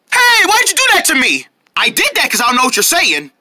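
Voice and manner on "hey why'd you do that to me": high-pitched complaint; indignant protest. Acted. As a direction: object in a high, wronged voice. Protest after being screamed at.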